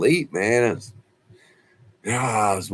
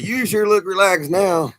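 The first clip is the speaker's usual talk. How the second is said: much higher, louder amused nurse voice; teasing nurse; 12 semitones higher, 5 dB louder than usual. Retold or imitated. Nurse teasing the slumped patient, high and amused. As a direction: lift way up like a nurse teasing him.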